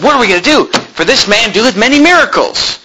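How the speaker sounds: high-pitched mock fretting; fretting priests. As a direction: whine it like worried schemers. Acts out the worried priests.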